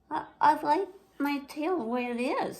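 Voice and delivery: high, hesitant little-sister voice; unsure and a bit shy; a small sister holding on to what she likes; timid little sister. Acted.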